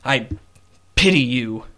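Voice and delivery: cold disowning father. Acted. Father to his accused daughter, coldly disowning. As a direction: cast her off with contempt.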